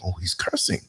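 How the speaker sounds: hushed, low shocked aside; softly voiced inner shock; shocked child. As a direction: imitate a kid quietly taken aback. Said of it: "So it shocked me".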